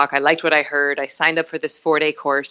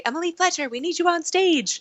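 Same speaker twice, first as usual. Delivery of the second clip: high, far-off announcer call over a PA; loudspeaker call; 13 semitones higher, 3 dB quieter than usual. Retold or imitated. Stage manager paging the understudy over the loudspeaker, high announcer voice. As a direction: page her like a backstage loudspeaker. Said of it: "someone would get on a loudspeaker and say".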